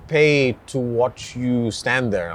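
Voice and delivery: usual speaking voice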